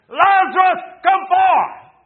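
high, full-force shout; roaring summons to the dead; anguished command. Acted. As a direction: bellow it through the upset. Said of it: "He is groaning under pain".

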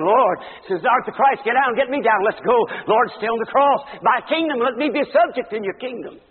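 loud, high, desperate plea from the cross; pleading thief. Acted. Preacher voicing the thief on the cross, loud pleading. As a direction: beg like a dying thief.

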